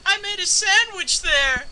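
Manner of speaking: high, drawn-out put-on retort; mock exclamation. Imagined husband answering the wife joke, in a high, silly exclamation. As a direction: pitch it way up and stretch it out. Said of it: "he'll go".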